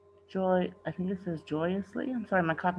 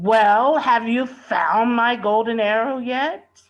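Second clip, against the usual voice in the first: loud, high, slow demand; pompous lord demanding his arrow; 6 semitones higher, 10 dB louder, slower than usual. A narrator's reading. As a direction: bark the question at the servants. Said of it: "Felipe loud and demanding".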